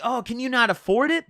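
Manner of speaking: high-pitched imitation of her jab; mock rude question. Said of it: "that's a real rude comment".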